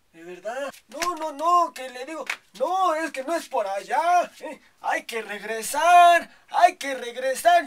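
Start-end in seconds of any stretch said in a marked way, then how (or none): 2.5-7.7 yelling. Retold or imitated. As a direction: call out to make them turn back. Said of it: "Yo les gritaba". (gritando para que regresen)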